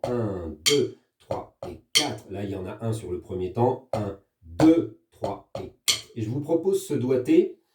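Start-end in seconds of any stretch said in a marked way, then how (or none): 0.0-2.3 slow, rhythmic count with DEUX brought out; counting accented beats. Shown as a demonstration. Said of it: "les accents sont toujours à peu près sur deuxième et quatrième temps". 3.9-6.1 slow count in time, big stress on DEUX; counting accented beats. Shown as a demonstration. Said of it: "sur deuxième et quatrième temps".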